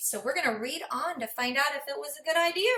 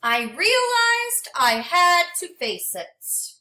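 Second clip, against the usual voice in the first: gentle, expressive storybook read-aloud; reading to children; 4 semitones higher than usual. Acted. Teacher reading a storybook to her class, expressive and gentle. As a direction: read it like a picture book for a class.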